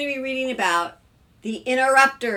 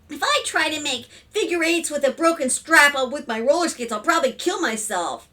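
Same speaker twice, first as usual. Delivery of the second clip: put-on higher, louder child voice easing down; complaining daughter; 6 semitones higher, 5 dB louder than usual. A narrator's reading. Daughter interrupts about broken skate strap, whiny child. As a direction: gripe like a kid with a grievance.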